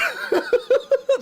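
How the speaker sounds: very high burst of giggling; high giggle. Real, not acted. Streamer giggling after imagining the confrontation, very high giggle. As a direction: giggle at own joke.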